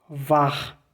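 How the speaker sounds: Var with throaty guttural final R; scene: teacher points out guttural R in German word. Shown as a demonstration. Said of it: "Olha o R gutural".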